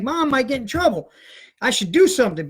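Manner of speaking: ordinary delivery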